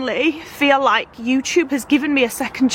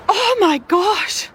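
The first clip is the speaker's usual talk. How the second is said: slow, high, emotional gasp; choked up; 8 semitones higher, much slower than usual. Real, not acted. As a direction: let it catch in your throat. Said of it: "make me feel this emotional".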